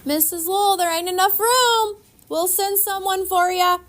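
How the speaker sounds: loud, high shout over the distance. A narrator's reading. Girl shouting back to the neighbour as they drift away. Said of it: "to shout at her".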